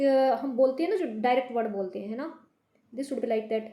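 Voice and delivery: ordinary talk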